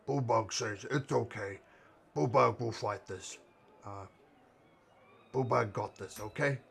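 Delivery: lightly voiced big Minotaur; Bullbug voice. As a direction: speak as the hulking Minotaur. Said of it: "That's what Bullbug sounds like".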